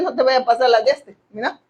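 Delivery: ordinary talk, nothing marked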